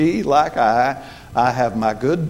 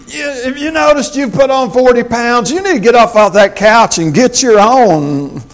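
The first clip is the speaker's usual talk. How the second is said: mock rude snap; 9 semitones higher, 6 dB louder than usual. Rude husband's answer to wife.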